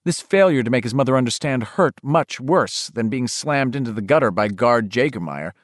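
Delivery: ordinary delivery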